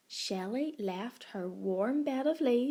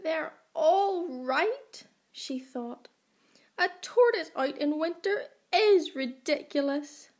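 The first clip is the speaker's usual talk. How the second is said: tearful; 6 semitones higher, 5 dB louder than usual. A narrator's reading. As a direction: let the tears into the words. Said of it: "a tear trickled down her cheek".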